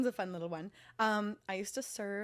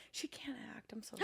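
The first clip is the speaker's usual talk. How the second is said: whisper; 15 dB quieter than usual. Acted. Whispered catty aside.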